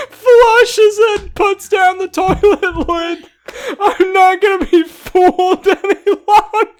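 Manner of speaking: high, loud reading through real laughter; crying with laughter. Real, not acted. Reading Swiper's speech to friends, crying with laughter. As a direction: fight to read while helplessly laughing. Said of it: "I'm crying".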